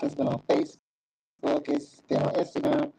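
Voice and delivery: choppy cutting-out connection; bad connection dropping words; audio breaking up. Real, not acted. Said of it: "It sound like you was breaking up".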